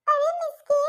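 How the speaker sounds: ordinary delivery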